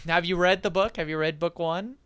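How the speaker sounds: ordinary talk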